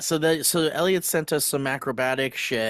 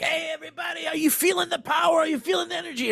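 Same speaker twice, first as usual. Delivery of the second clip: high, shouted emcee hype; hype host shout; faster than usual. Retold or imitated. Clown host hyping the audience, high shouted emcee. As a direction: pump up the crowd like a game-show host. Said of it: "he'll come out and be like".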